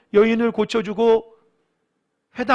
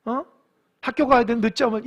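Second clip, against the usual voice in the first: normal talking voice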